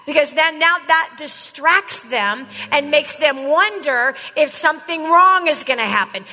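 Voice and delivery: ordinary delivery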